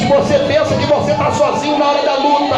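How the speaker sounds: high, loud, driving exhortation; full-force preaching. Real, not acted. Preacher urging those alone in the struggle, full-force preaching. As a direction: preach hard and high to the whole congregation.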